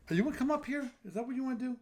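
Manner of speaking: high cooing voice to a cat; high pet voice. Real, not acted. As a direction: coax the cat up sweetly. Talking to the cat.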